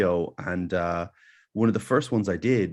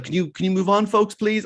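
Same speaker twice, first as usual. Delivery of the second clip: impatient mock plea; 11 semitones higher, 5 dB louder than usual. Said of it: "the lady, like, was like".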